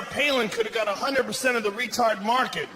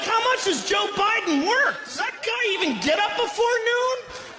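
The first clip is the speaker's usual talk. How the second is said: much higher, incredulous pitch; incredulous questioning; 13 semitones higher than usual. Acted. Comic doubting a vice president's workload, incredulous questioning. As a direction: ask it in high-pitched disbelief.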